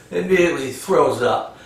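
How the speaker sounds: usual speaking voice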